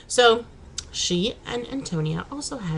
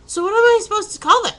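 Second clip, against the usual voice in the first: high, loud, fast mock outrage; exasperated question; 12 semitones higher, 10 dB louder, faster than usual. Real, not acted. To husband about fries that aren't fried, exasperated. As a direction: throw the question back in disbelief. Indignant at "freedom fries" naming.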